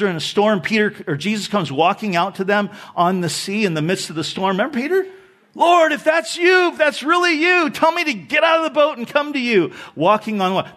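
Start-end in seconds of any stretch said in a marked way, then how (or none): 5.5-9.7 high, loud and eager; bold plea. Acted. Peter calling to Jesus across the storm, bold and eager. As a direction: call out boldly, pitched well above usual. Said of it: "That is bold".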